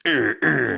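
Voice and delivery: loud high vintage radio stinger; mock fifties sound effect. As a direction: mimic an old fifties jingle. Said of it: "sounds like this fucking thing from the fifties".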